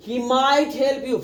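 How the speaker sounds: very slow, every word drawn out; slow demo. Shown as a demonstration. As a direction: spell it out slowly for the class. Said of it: "first बोलो". (बहुत धीरे-धीरे, हर शब्द खींचकर)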